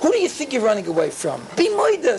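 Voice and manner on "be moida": urgent command. Real, not acted. Preacher urging the listeners.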